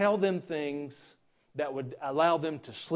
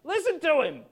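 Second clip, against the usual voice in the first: loud, high-pitched heckler's yell; shrill-pitched shouted interruption of a sermon; screaming out; 11 semitones higher, 6 dB louder than usual. Acted. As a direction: scream it across the room, acting it out. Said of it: "Somebody's screaming at you".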